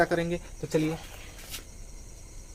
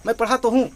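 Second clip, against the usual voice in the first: "तो" hit hard, pitch up and louder; emphatic jolt; 5 semitones higher, 5 dB louder than usual. Shown as a demonstration. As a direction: jerk the words out with force on "तो". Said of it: "झटके से". ("पढ़ा तो हूँ" झटके से, ज़ोर देकर)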